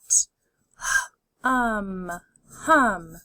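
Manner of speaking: d, ot, dot, parts pulled apart then joined; D, ot, dot: each part separate, then the whole word; segmented then blended. Shown as a demonstration. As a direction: sound it out and blend it. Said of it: "Keep saying 'em out loud".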